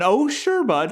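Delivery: high, loud Canadian "Oh, sure, bud", going up; Canadian-style rise on "sure"; Canadian lilt. Retold or imitated. Showing friends the Canadian up-then-down tune. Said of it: "almost like a Canadian".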